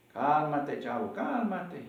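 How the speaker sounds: usual speaking voice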